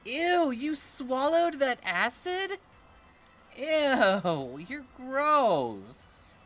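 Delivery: high, loud disgusted squeal; grossed out. Real, not acted. Watching the Pokemon swallow acid, a loud grossed-out squeal.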